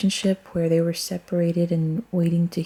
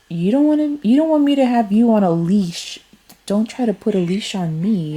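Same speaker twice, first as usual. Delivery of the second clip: high, louder protest; indignant; 3 semitones higher, 3 dB louder than usual. Real, not acted. Reader refusing to be put on a leash by him, indignant. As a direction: push back with raised pitch and volume.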